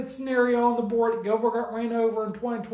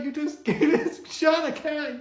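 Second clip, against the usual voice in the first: laughing; 5 semitones higher than usual. Real, not acted. Laughing while telling it.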